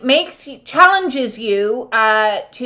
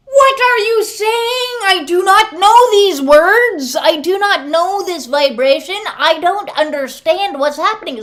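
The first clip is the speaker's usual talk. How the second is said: stiff alien who can't parse love; mock confused robot; 9 semitones higher, 5 dB louder than usual. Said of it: "And you're like".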